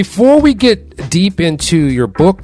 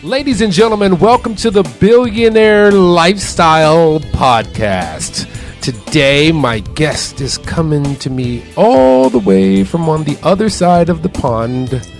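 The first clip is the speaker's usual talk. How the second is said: punchy, higher-pitched host introduction; show-host intro; 6 semitones higher than usual. Acted. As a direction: open the show like an announcer. Podcast host opens the show.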